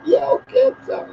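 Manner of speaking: put-on tiny, very high child voice; little kid apologizing. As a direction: imitate a sheepish little kid, squeakily high. Acts out little kids apologizing.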